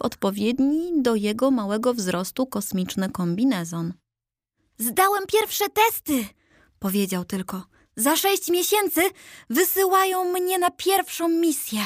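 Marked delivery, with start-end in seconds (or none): 4.8-11.8 much higher, odd little character voice; high, strange-sounding figure sharing big news; proud statue voice. Acted.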